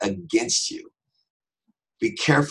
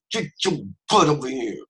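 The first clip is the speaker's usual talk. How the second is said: raised pitch, tight-jawed parental warning; mouth not moving; 5 semitones higher than usual. Acted. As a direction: order squeezed through a still mouth. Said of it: "when the mouth doesn't move".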